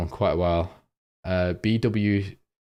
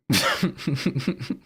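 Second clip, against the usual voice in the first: higher-pitched chuckling fit; helpless laugh; 6 semitones higher than usual. Real, not acted. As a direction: keep cracking up, unable to stop. Real laughing fit at crude joke.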